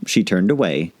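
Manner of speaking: usual speaking voice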